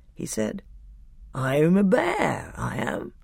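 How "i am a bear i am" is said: slow, drowsy bear; thick, sleepy voice. A narrator's reading. The bear humbly addresses Peter, thick and sleepy. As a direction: draw it out thickly, half asleep. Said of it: "sleepy voice said".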